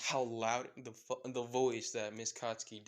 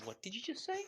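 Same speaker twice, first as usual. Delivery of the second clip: high, questioning warning; menacing challenge; 12 semitones higher, 4 dB quieter, faster than usual. Acted. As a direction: dare the kid to repeat it. Mother catches the muttered insult.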